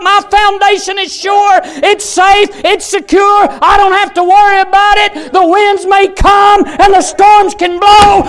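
high, impassioned pulpit shout; real fiery preacher's cry; full-force preaching. Real, not acted. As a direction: drive each phrase home with fervor.

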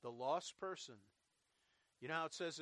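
usual speaking voice

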